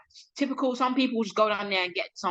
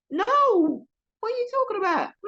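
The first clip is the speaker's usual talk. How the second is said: very high, louder, stretched-out indignation; exasperated protest; 14 semitones higher, 4 dB louder than usual. Retold or imitated. Guest asked about work clothes, high exasperated denial. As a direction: cry out in affronted disbelief, pitched way up. Retells protest at being taken for staff.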